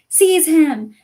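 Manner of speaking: high, loud screamed command; screamed order. Acted. Voldemort ordering Quirrell to grab Harry, screaming. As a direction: scream it at the servant. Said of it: "Voldemort screamed".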